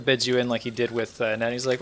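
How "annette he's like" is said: ordinary delivery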